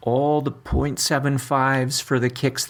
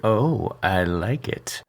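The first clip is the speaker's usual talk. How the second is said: sultry voice; 2 semitones lower, much slower than usual. Pretend. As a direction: drop into a slow seductive voice. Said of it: "You don't like my sultry voice".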